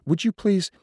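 high, loud, cut-off request; remonstrating usher. A narrator's reading. Usher tries to get a man to sit, remonstrating. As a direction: plead insistently, pitched well up.

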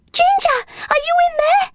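very high, quick call to a lost pet. A narrator's reading. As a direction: call through the door in a high, urgent voice. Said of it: "she called".

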